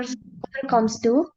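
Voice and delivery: ordinary talk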